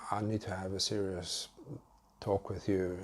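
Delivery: mildly solemn, as if bad news is coming; sad, serious tone. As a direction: retell it gravely, lightly downcast. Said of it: "sad, you know, serious tone to my voice".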